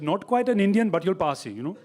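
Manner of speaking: imitating an Indian local; Indian-accented punchline delivery. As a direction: explain it in a put-on Indian accent. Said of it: "when I went to India".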